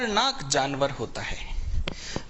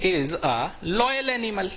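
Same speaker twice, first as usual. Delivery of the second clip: slow dictation; 3 semitones higher, much slower than usual. Shown as a demonstration. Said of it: "teacher reads example slowly".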